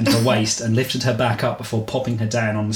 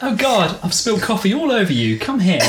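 higher, flustered English lady; flustered woman; 9 semitones higher than usual. Acted. Woman spilling coffee on Byron, flustered and higher. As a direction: fuss over the spill. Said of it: "She started dabbing".

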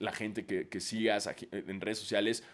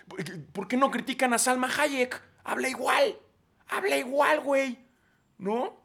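high, loud, exasperated rant; fired-up exasperation; worked-up rant; 13 semitones higher, 9 dB louder than usual. Real, not acted. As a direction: push it up in disbelief. Heated rant about accents.

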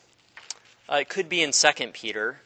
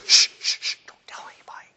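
fast whispered hush; faster than usual. Retold or imitated. Jesus hushing the crowd, fast whisper. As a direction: shush them like a secret. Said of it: "And Jesus goes".

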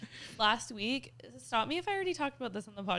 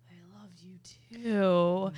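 grudging mumble; 4 dB louder, slower than usual. Retold or imitated. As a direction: mumble it reluctantly, still huffing. Child's grudging reply to mom.

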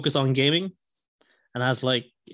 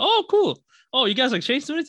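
much higher, bright surprised quote; retelling his eager first words; mock excited; 11 semitones higher, 3 dB louder than usual. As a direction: replay the thrill of meeting them. Retelling his own excited reaction.

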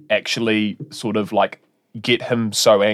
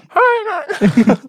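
very high, squeaky mock puppet greeting; ventriloquist's puppet; 3 dB louder than usual. Puppet on Melania's lap greets the crowd, high ventriloquist's puppet. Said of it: "Melania's got Trump on her fucking lap".

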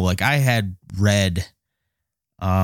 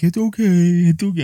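high, put-on soothing wife voice; 10 semitones higher, 4 dB louder than usual. Wife soothing her crying husband, high and put-on. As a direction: pat him down gently. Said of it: "she was kinda laughing at me".

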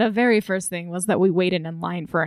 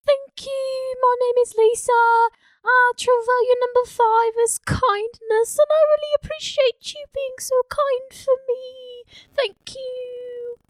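very high, gentle troll voice; a kind little troll, pitched way up. Acted. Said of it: "the troll voice".

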